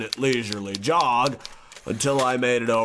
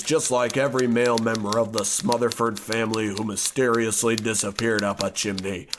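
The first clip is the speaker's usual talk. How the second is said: ordinary delivery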